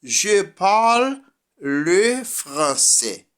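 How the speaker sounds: slow, high teacher reading in French; slow French example. Shown as a demonstration. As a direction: read the French example sentence slowly. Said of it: "unas frases en francés".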